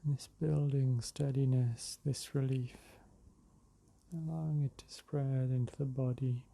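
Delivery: unhurried meditation voice with long pauses; guided meditation. Real, not acted. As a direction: ease them into the breath, drawing words out. Leads a breathing meditation.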